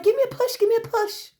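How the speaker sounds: hammed-up "Give me a push"; mock pleading. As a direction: beg like a silly child for a shove. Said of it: "You're over there like".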